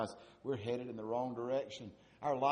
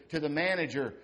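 usual speaking voice

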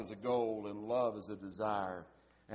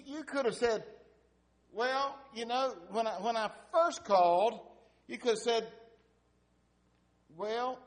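much higher, louder scolding wife voice; the upset wife; 9 semitones higher, 5 dB louder than usual. Retold or imitated. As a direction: scold him in a high, exasperated wife's voice. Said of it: "She said".

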